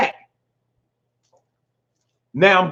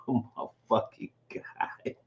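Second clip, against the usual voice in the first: scared unvoiced whisper; terrified whisper climbing stairs; 4 semitones lower, 15 dB quieter than usual. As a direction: breathe it out in fear.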